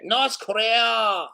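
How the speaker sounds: put-on slow, drawn-out North Korea in a Korean accent, half shouted; local Korean accent. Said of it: "I'm just gonna say it like they say it".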